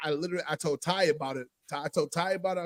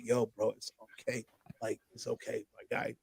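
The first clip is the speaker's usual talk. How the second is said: much softer, lower, gentle; soft reassurance; 8 semitones lower, 12 dB quieter than usual. Retold or imitated. Telling his late brother it's okay, soft and tender. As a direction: comfort him quietly.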